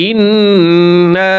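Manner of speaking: ইন্না with the nasal ন্ন held long and slowly; ghunnah demo. Shown as a demonstration. Teacher showing students the ghunnah on ইন্না, slow nasal hold. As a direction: hum the doubled ন্ন of ইন্না through the nose, drawn out. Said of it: "এক আলিফ গুণনা করব".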